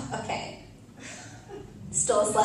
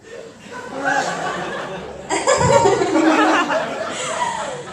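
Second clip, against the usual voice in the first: long cruel laugh; 10 semitones higher, 5 dB louder than usual. Acted. Cruel laugh after story.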